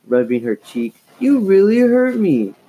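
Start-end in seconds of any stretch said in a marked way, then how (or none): 1.2-2.5 loud, high wounded accusation; hurt accusation. Acted. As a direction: accuse her sister loudly. Slapped sister accuses.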